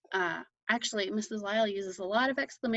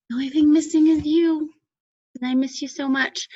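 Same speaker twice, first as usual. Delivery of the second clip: missing you; 6 semitones higher, 8 dB louder than usual. Real, not acted. Teacher missing her students.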